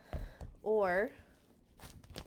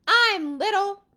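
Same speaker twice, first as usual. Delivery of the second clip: much higher, loud defiant shout; 12 semitones higher, 12 dB louder than usual. Acted. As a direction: yell it up at the giant. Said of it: "David shouted up at him".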